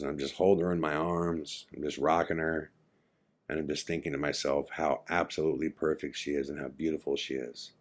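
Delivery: soft, low retold tenderness; hushed recounting. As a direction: quietly retell a friend's nightmare. Friend's nightmare, cradling his daughter.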